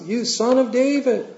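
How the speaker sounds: high mock cry, no real volume; imitated crying out. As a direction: call out high like a retold scream. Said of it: "screaming out at the top of their lungs".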